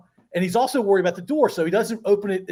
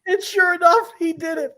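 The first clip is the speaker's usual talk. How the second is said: words squeezed out through laughter; real laughter cracking the voice; laughing while talking; 9 semitones higher, 3 dB louder than usual. Real, not acted.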